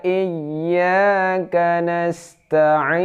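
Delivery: high, very slow chant; Quran recitation. Shown as a demonstration. Teacher reciting the fifth verse, high slow chant. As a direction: draw out each syllable melodically. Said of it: "Sekali lagi".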